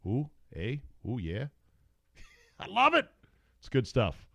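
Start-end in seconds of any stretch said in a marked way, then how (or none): 0.1-1.5 playful Canadian eh; Canadian accent. Retold or imitated. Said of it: "And that Canadian accent, eh?". 2.0-3.1 laughing, high-pitched. Real, not acted. Laughing after accent bit.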